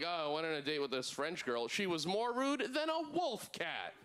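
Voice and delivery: mock sarcasm, climbing high and slowing at the end; sarcastic. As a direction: parody someone's sarcastic remark, stretching the punch line. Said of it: "say it sarcastically".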